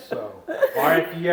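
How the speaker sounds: quick, laughing delivery. Real, not acted. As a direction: rush the joke while chuckling. Jokes "you're probably Cajun.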